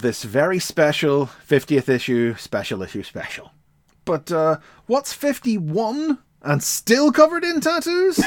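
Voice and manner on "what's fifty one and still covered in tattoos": mock announcer riddle. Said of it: "announcer teaser riddle".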